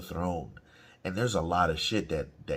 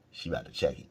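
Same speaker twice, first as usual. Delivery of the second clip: normal talking voice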